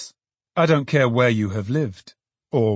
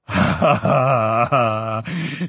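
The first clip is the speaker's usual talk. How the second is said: laughing ha ha; much slower than usual. A narrator's reading. Trotty laughs with joy.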